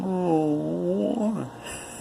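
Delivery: short strange laugh up high; a mad little laugh; creepy narrator chuckle; eerie high laugh. A narrator's reading.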